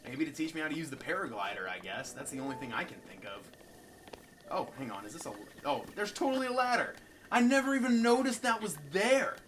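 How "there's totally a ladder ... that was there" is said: high, loud disbelief; astonished, raised-voice realisation; amazed discovery. Real, not acted. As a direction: exclaim it as you spot the obvious. Discovers a ladder missed before.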